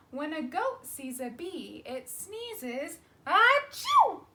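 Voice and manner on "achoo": loud, high achoo; one bright big sneeze. Acted. Story ends with sneeze.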